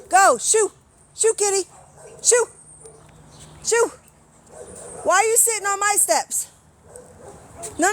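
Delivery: high, loud calls to shoo the cat. Real, not acted. Owner calling loudly to a stray cat on her steps, shooing it off.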